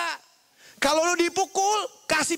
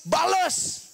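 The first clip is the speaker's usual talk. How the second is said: higher, drawn-out command; urging retaliation; 4 semitones higher than usual. Retold or imitated. Big brother teaching younger one to hit back, urging command. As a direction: push the word out like an order. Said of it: "kokoh saya ngomong begini".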